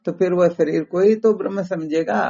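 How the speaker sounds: ordinary delivery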